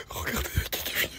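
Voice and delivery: fast hushed whisper. Real, not acted. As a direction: whisper it quickly so nobody hears. Secret surprise guest.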